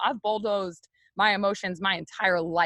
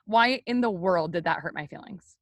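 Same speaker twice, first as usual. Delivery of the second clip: normal talking voice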